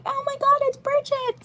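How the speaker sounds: put-on very high farm girl squeal; delighted high-pitched farm girl gasp; excited farm girl accent, pitched very high; Bridget farm girl accent. Shown as a demonstration. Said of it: "the Bridget accent".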